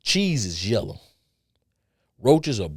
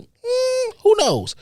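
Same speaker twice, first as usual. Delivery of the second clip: 1 coy tease; 6 dB louder, much slower than usual. Real, not acted. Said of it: "teasing himself".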